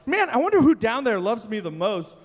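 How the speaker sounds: high, loud delighted God voice; excited God. Retold or imitated. God watching from heaven, loudly delighted. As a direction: play God thrilled by the shirt-ripper. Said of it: "God in heaven looking down like".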